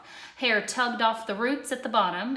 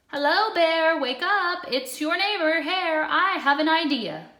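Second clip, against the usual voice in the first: loud, high, cheery wake-up call; Hare calling; 7 semitones higher, 7 dB louder than usual. A narrator's reading. Hare wakes his sleeping neighbor Bear, loud cheery call. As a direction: rouse the sleepy bear brightly.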